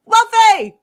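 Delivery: high, loud holler of the name; excited shouted greeting. Real, not acted. Co-host hailing Wolfie on arrival, excited shout. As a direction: call out across the room, high and full-voiced.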